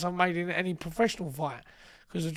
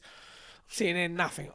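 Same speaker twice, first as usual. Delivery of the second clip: ordinary talk, nothing marked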